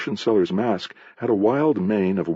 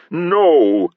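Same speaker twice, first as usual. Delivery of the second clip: high, loud stage denial; theatrical shout; 7 semitones higher, 5 dB louder than usual. A narrator's reading. Masker contradicting the potion seller, theatrical shout. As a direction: call it out as a grand denial. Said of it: "shouted Mebecue in his deepest voice".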